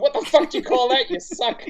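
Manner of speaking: high, mock-angry self-berating; mock self-scolding. Filmmaker scolding himself over his own work, mock rage. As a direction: yell at yourself over bad work, half in jest. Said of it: "Punching myself in the face, going".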